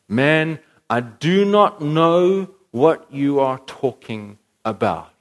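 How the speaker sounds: slow, emphatic mock Galilean accent; loud, laboured take on a thick Galilean accent. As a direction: put on a heavy Galilean accent, drawn out. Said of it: "in his thick accent".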